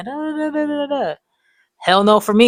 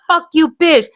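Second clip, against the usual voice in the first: retold yelled curse; 11 semitones higher, 6 dB louder than usual. Kid cursing at dragging teachers.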